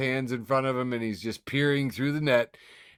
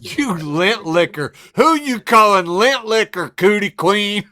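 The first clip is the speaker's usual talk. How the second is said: high, loud mock insult from a commercial; gum ad taunt; 9 semitones higher, 10 dB louder, slower than usual. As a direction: hurl it like the kid in the ad. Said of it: "It is from an Orbitz, uh, gum commercial".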